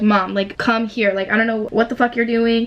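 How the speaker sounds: ordinary delivery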